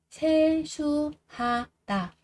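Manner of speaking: 세수하다 slowly split as 세-수-하-다; syllable by syllable. Shown as a demonstration. Teacher drilling learners on 세수하다, slow and separated. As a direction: spell out 세수하다 one slow syllable at a time.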